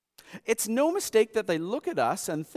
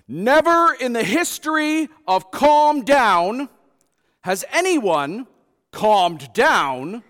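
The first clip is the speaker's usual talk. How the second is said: loud, high and drawn out; slow loud mock proclamation; 8 semitones higher, 11 dB louder, slower than usual. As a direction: read the sign like a grand proclamation. Reading out a funny road sign.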